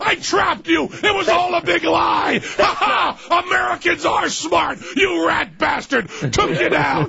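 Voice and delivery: hammed-up victory yell; mock triumphant shout. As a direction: gloat like you trapped him.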